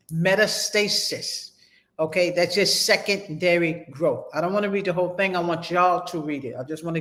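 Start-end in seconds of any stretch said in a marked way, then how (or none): 0.1-1.5 metastasis said very slowly, every syllable laid out; slow careful term. Shown as a demonstration. Teacher dictating the term metastasis, slowly. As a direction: drag out metastasis so students can write it. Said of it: "So metastasize and metastasis".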